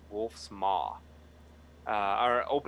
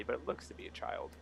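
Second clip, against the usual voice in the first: ordinary delivery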